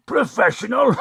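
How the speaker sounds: scoffs the word, then bursts out laughing; mocking laugh. Thug laughing at a rival who calls himself professional, mocking laugh. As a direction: laugh in his face at the idea.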